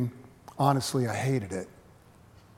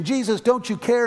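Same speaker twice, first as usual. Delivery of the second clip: raised, higher pleading cry; mock angry yell; 9 semitones higher, 6 dB louder than usual. Frightened disciples yell at Jesus, higher and louder. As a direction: voice the disciples yelling in frustration. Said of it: "They yell in anger and frustration".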